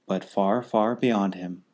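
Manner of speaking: usual speaking voice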